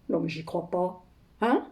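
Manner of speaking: suddenly low and soft; muted disbelief. Acted. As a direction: drop the voice, stunned by the accusation. N'en revient pas de l'accusation. (incrédule, à voix basse)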